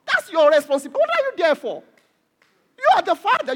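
loud, high-pitched scolding; forceful rebuke. Real, not acted. Preacher to parents dodging responsibility, loud indignant rebuke. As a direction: raise your voice and take the parents to task.

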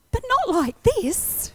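high, slow plea; begging God, drawn out; a high, drawn-out protest; pleading. Retold or imitated.

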